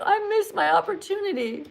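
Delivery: whiny high-pitched mock sob; mock crying. Teacher imagining the ejected caller in bed, mock crying. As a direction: wail it in mock self-pity, high-pitched. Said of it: "You're gonna be in bed crying".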